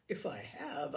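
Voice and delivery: plain reading voice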